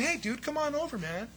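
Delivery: a laid-back hey dude; the not-pleading version, offhand and casual; casual invite. Retold or imitated. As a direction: toss it off like nothing matters. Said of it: "He's not just saying".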